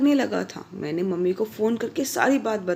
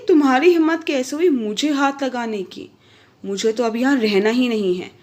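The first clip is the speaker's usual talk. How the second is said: furious retort; 3 semitones higher, 5 dB louder than usual. Acted. Slapped wife shouting at her husband, outraged. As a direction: hurl it back at him.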